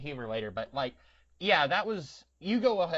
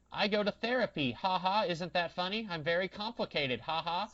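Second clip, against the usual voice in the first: put-on higher-pitched little-kid sitcom voice; mock little girl; 5 semitones higher than usual. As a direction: recite it as a child's punchline with fake ha-has. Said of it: "the little girl, it's like".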